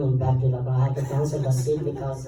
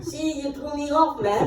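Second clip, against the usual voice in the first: much higher, laughing mock blame; laughing complaint; 13 semitones higher, 4 dB louder than usual. Real, not acted. Actor blames interviewer for losing his place, laughing. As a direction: blame them playfully through real laughter.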